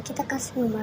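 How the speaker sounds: ordinary talk, nothing marked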